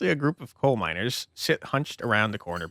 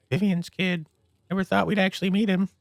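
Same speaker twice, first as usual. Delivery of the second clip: higher, gossipy small-town voice; gossiping townsfolk; 8 semitones higher than usual. Acted. Diner patron gossiping about Vivian's kid, in a higher voice. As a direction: lean in and gossip about the newcomer.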